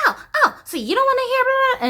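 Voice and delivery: high, fast mocking mom voice; mock-whiny mother dismissing her. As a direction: whine it out like the mother brushing her off. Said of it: "keep ignoring me".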